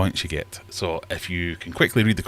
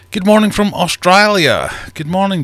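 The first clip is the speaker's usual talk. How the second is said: high, loud Australian greeting; put-on Australian accent; 12 semitones higher, 9 dB louder than usual. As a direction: call out a hammed-up Australian good morning. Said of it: "Sorry for the accent".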